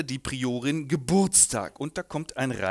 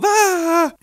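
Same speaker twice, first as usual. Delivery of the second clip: high, loud frightened scream; 11 dB louder than usual. Acted. Hunter frightened.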